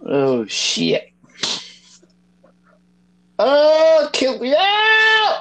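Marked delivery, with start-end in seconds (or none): 3.4-5.4 slow anguished shout. Real, not acted. Game loss outburst.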